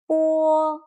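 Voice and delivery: 波 held high and level; 波 in the flat high first tone. Shown as a demonstration. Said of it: "practice the four tones".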